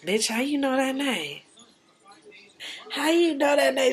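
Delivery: high, hushed shocked question; stunned Marie. Retold or imitated. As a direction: Marie caught off guard, asking high and soft. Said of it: "Marie ass face got tight".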